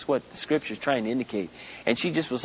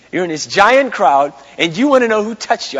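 razz him like he's lost his mind; mocking disciples; 6 semitones higher, 10 dB louder than usual. Disciples teasing Jesus about the crowd, loud and scoffing. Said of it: "They say".

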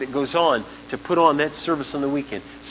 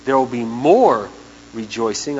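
"more" said louder and slowly; stress on more; 4 semitones lower, 4 dB louder than usual. Real, not acted. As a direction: lean hard on "more". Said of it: "I underline the word more".

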